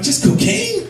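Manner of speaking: high mock-innocent question; squeaky disbelief. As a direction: ask it like it's the obvious guess. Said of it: "I'm like".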